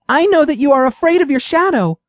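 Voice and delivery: mouse voice. A narrator's reading.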